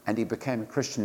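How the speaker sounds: ordinary talk, nothing marked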